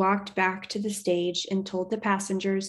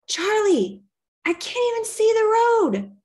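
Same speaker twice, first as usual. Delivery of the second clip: high, loud shout into the wind; a nervous man bellowing over a howling storm; yelled over the storm; 6 dB louder than usual. Acted. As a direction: shout so she can hear you over the rain. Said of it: "he yelled".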